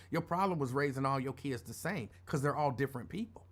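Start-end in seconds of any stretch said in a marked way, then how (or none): none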